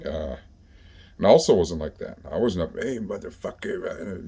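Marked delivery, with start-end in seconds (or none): 2.8-4.3 slow, hushed mock callout; mock tough guy. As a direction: draw out a mock challenge, softly. Imitates someone acting tough.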